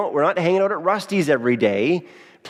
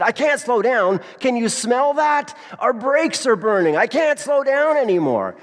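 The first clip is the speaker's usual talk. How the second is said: high, alarmed cry that the brakes are burning; mock panic, voice jumping up high; retold panicked shout; 8 semitones higher than usual. Husband tells wife the brakes are failing, alarmed high shout.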